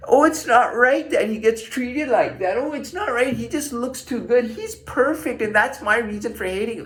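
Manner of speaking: mocking high-voiced complaint; complaining girls. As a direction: imitate girls complaining, pitched well up. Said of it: "it's even girls, they'll complain".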